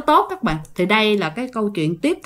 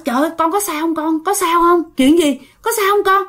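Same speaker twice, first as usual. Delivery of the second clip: high, worried cries of a mother; panicked mother; 6 semitones higher than usual. Retold or imitated. Mother fussing over her fainted daughter, alarmed and high. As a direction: rush out the questions in alarm.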